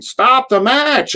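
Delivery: high, stretched-out mock yell; imitated yelling. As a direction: copy the heckler's cry, long and high. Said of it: "And I'm yelling".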